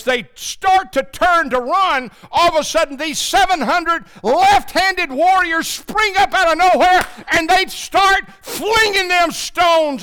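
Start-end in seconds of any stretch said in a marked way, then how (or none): 2.3-10.0 much higher and louder, building to a shout; preaching at full force. Acted. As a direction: hammer the climax home, slowing at the end. Preaching the climax.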